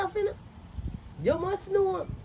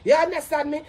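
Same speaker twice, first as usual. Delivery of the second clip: usual speaking voice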